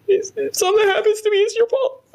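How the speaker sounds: very high, fast mock wail; hammed-up crying accusation; tearful blaming. Tearful parting threat after firing.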